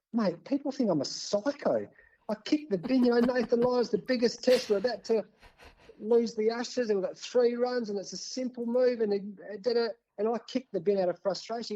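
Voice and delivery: frustrated pleading. Retold or imitated. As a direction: argue the case high and worked up. Pleading with producer about bin footage.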